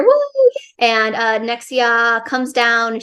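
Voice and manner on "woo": high-pitched "woo" of a cheering crowd. Acted. Narrator mimics the crowd after the fight, high cheering. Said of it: "the crowds cheer".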